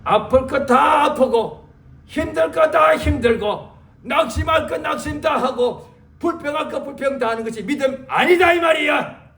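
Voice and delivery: loud, high sermon climax; fiery preaching. Acted. Pastor driving the point home to his church, loud. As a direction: preach it with full force.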